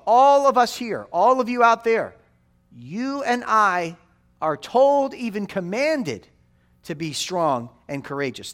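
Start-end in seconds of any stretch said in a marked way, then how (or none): 0.1-6.3 rousing call. Real, not acted. Preacher rallying the congregation.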